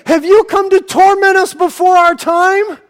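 high, loud anxious plea; fearful demons. Acted. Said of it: "And then they would say".

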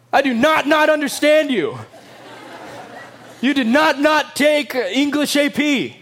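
teasing the congregation with a silly example; mock double negative. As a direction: poke fun at bad grammar. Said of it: "what happens when we use double negatives?".

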